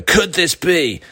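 over-the-top celebrity voiceover teasing a mystery; excited voiceover. Retold or imitated. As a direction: sell the mystery like a breathless documentary voiceover. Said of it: "super high octane, really excited, uh, maybe celebrity voiceover person".

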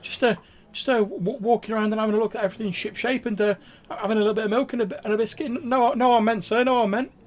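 very high, loud, fast stammering sprite; Grimwald, petrified squeak. Acted. Petrified sprite excuses himself to a guest, squeaky and stammering. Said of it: "in, like, a really, like, squeaky, high-pitched voice".

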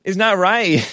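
high, slow, whiny mock voice of a young woman; high mock-pleading immigrant girl voice; mocking accented whine. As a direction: pitch it up high and drag it out, mocking. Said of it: "talking like that".

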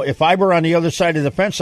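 normal talking voice

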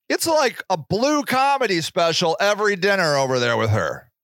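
ordinary delivery

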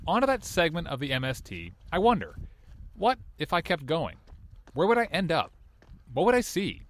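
measured documentary narrator voice; story narration. A narrator's reading. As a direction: narrate it like a podcast host. Podcast narration.

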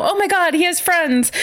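high, gushing proud mom; excited mom. Retold or imitated. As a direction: squeal it like a thrilled mother. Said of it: "And then Beverly's like".

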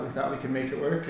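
usual speaking voice

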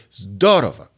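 zdorowa stressed hard on its first syllable. Shown as a demonstration. Teacher showing shifted accent, first-syllable stress. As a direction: hit "zdo" in zdorowa, let the rest fall. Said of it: "put it on the first syllable".

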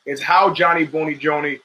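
ordinary talk, nothing marked

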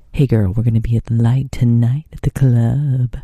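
joking imitation of a celebrity inviting a girl out; mock smooth guy. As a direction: play a cheesy ladies' man. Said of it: "He didn't say it like that".

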